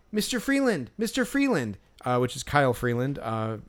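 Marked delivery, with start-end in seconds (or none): 0.1-1.8 high calling out a name across the crowd; imitating an eager kid calling out; kid yelling. Fan kid calls to the pitcher, mock eager yell. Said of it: "we hear this kid yelling".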